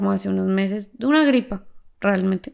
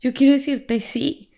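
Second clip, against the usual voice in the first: retold tearful prayer; 6 semitones higher than usual. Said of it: "Y me puse a llorar".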